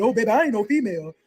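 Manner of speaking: a hint of a deep, cocky prisoner voice; deepened voice. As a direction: drop the voice a little for a swaggering stud. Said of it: "her voice got deep".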